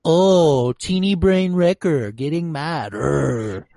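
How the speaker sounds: lightly voiced sneering Crosshair, slowed; mocking Crosshair. Crosshair taunting Wrecker, slow and mocking. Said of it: "And Crosshair's like".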